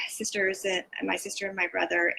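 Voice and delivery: ordinary talk, nothing marked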